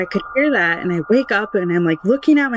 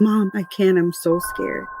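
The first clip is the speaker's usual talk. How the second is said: ordinary talk, nothing marked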